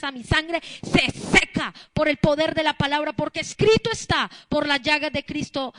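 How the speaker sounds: loud, forceful healing declaration; full-force preaching. Acted. Preacher modeling a declaration against sickness, loud and forceful. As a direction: drive each phrase out like a decree.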